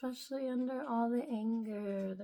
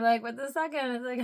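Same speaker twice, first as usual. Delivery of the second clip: ordinary delivery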